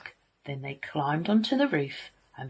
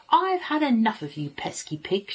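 exasperated mother pig, pitched up; fed-up scolding; 6 semitones higher, 4 dB louder than usual. A narrator's reading. Mother pig to her naughty piglets, fed-up scolding. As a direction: scold naughty children, mildly cross.

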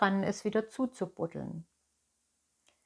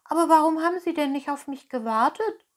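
higher, fast, let-down question; disappointed; 8 semitones higher, 6 dB louder, faster than usual. Acted. Pinocchio asks innkeeper why friends left, disappointed. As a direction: ask it hurt and let down, voice jumping up. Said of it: "fragte Pinocchio enttäuscht".

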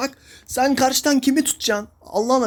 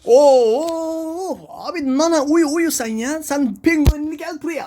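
mocking sarcasm; 5 semitones higher, 4 dB louder, slower than usual. Real, not acted. Mocking teammate's Nana play.

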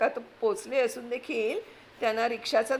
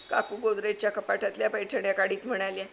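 ordinary talk, nothing marked

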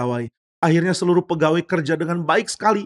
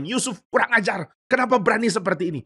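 pitched-up indignant scolding; mildly acted outrage, voice raised high; angry crowd; 7 semitones higher than usual. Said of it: "semua orang marah bukan main".

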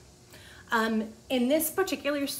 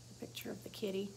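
breathy whispered aside about the kitty; 11 dB quieter, faster than usual. Real, not acted. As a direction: whisper it while showing the page. Whispered aside over the picture.

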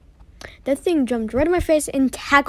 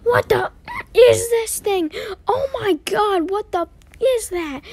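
panicked; 10 semitones higher, slower than usual. Acted. Panicked at a monster.

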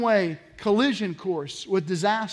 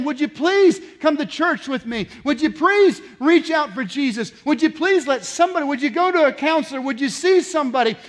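insistent, higher-pitched pleas; acted pleading; 6 semitones higher, 5 dB louder than usual. Grandma urging grandchild to church or counseling, acted pleading. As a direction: press each plea on a loved one. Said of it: "Grandma's saying".

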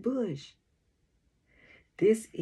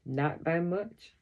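ordinary delivery, nothing marked

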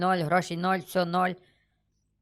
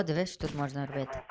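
normal talking voice

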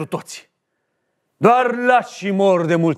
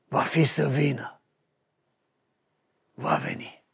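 softer, fading whisper of a close; hushed ending; 5 semitones lower, 8 dB quieter than usual. Acted. Caesar's final line fading to a whisper. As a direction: let the last words sink to a hush. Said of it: "Perfect, maestre".

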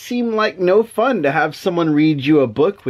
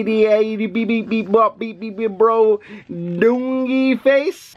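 silly nonsense sounds; 5 semitones higher than usual. Pretend. Dad reading nonsense aloud to his kid, high silly babble. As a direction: babble the nonsense like a goofy cartoon. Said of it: "silly sounds".

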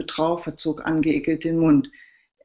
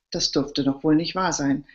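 ordinary delivery

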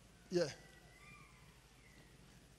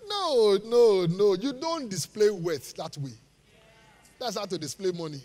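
normal talking voice

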